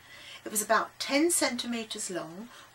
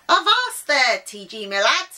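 loud, hearty pirate greeting; old sailor's hail; 4 semitones higher, 10 dB louder than usual. A narrator's reading. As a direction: hail the boy like an old sea dog. Old sea salt hails the boy.

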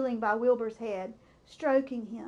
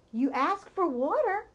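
ordinary delivery, nothing marked

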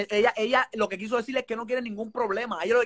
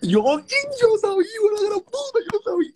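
triumphant shout; 12 semitones higher, 4 dB louder than usual. Real, not acted. Proven right about the romance, a high triumphant yell. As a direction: crow that you were right all along.

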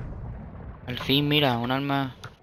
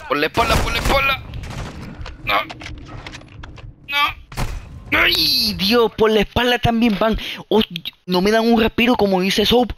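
panicked yelling; 9 semitones higher than usual. Real, not acted. Panicking mid-firefight, enemies behind.

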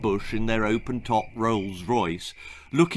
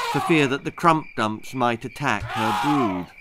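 usual speaking voice

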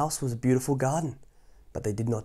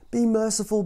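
high pleading voice; begging; 10 semitones higher, 4 dB louder than usual. Acted. Husband begging the witch for mercy. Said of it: "begged the man".

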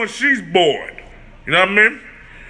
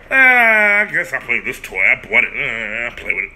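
a lightly voiced bored girlfriend; mocking the girlfriend; 12 semitones lower than usual. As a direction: shrug it off like she doesn't care. Mocking the woman's careless attitude.

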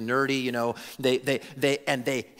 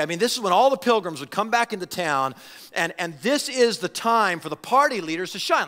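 ordinary talk, nothing marked

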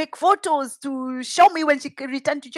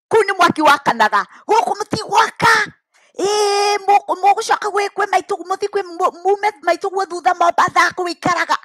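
angry shouting; 7 semitones higher than usual. Retold or imitated. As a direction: bellow it out in rage. Dad's angry reply in Kikuyu.

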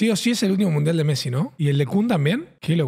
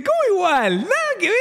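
indignant mock retort; 9 semitones higher than usual. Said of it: "le dice".

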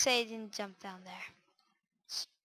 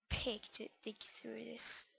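whispering; 8 dB quieter, slower than usual. Real, not acted. Quiet aside while digging.

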